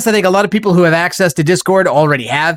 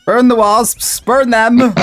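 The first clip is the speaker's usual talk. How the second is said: excited shout; 8 semitones higher, much slower than usual. Real, not acted. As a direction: yell it as you attack. Attacking wasps in game.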